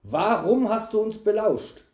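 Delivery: high, loud accusing question; angry demand. A narrator's reading. Knight questioning caught eavesdropper, angry demand. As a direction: demand an answer from the culprit. Knight interrogates the seized servant.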